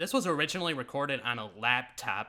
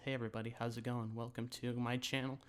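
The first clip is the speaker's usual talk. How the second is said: much softer, nervous mock intro, quick at the start; timid YouTuber intro voice; 10 dB quieter than usual. Mocking her old nervous channel intro to viewers, much softer. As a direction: mumble it like a shy beginner afraid someone hears. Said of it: "we just start talking like this".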